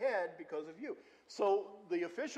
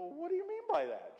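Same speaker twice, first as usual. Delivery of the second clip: high, baffled retort in a borrowed voice; baffled question; 10 semitones higher than usual. Retold or imitated. Pastor taken aback by the doctor's advice, high and baffled. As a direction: toss the question back, thrown off guard.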